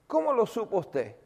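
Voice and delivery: a hint of tearful disbelief; retold tearful question. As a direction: mimic her tearful amazement. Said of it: "comienza a llorar".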